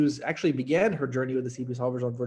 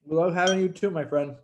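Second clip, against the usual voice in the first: usual speaking voice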